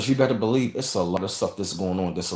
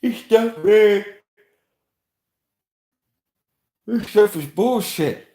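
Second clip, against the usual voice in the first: high, slow put-on voice; 12 semitones higher, 8 dB louder, slower than usual. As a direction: drag it out in a silly high voice. Mocking voice aside.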